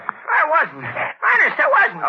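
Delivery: scared protest. Acted. Henchman protests he wasn't sneaking off.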